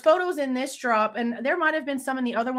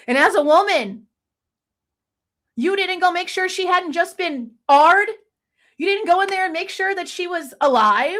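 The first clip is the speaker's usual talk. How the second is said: higher, rising in outrage; real anger; 6 semitones higher, 3 dB louder than usual. Real, not acted. Vlogger confronting Dylan, higher and angry. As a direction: demand it of Dylan, voice climbing. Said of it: "I'm so mad".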